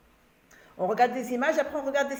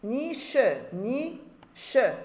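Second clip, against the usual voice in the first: "Ni che" split into two separate syllables; much slower than usual. Shown as a demonstration. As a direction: say "ni", then "che", each held on its own. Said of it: "Ce mot est déjà en ordre".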